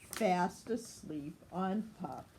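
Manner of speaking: usual speaking voice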